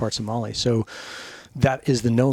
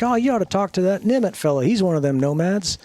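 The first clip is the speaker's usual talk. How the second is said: put-on folksy old boss; 8 semitones higher than usual. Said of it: "and he's like".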